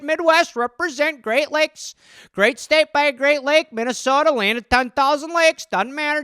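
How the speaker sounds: a lightly voiced Midwest fisherman; Midwest accent. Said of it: "regional American accents".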